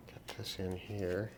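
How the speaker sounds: usual speaking voice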